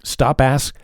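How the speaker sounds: usual speaking voice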